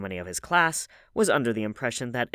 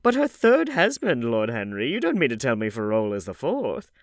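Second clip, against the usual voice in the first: put-on old lady voice; 3 semitones higher, 8 dB louder than usual. A narrator's reading. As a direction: chatter like a gossipy grand dame. Hostess asks.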